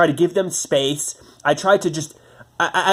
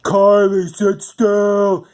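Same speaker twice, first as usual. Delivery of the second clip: higher, louder mock bark at the dog; mocking stepdad's yell; 5 semitones higher, 5 dB louder, much slower than usual. Mocking the stepdad scolding the dog, higher and louder. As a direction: ham up his impatient order. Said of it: "And he's like".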